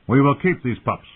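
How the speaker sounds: loud firm command. Acted. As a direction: declare it with authority. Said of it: "sounded as commanding".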